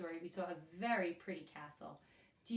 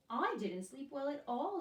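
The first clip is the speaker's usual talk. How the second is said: princess replying; 6 semitones higher, 4 dB louder than usual. Acted. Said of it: "she replied".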